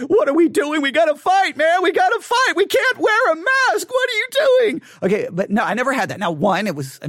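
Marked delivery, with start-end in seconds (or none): 0.1-4.8 high, loud mock panic; hammed-up freedom fighter; mock conspiracy ranter. Imitating a mask refuser, high and hammed-up.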